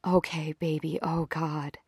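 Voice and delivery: soft, slow murmur to a lover; hushed lovers' whisper, still lightly voiced; near-whisper. A narrator's reading. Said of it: "She whispers".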